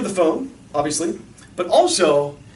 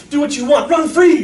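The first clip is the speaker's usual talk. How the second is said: higher, louder, rising exuberance; mock carefree cheer; 8 semitones higher, 7 dB louder than usual. Selling kids the lie of freedom, mock exuberant. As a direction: sell it like a gleeful slogan.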